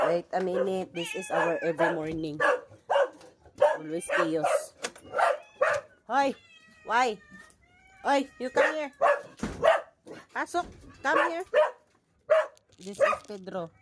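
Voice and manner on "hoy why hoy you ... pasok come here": calling the dogs. Real, not acted.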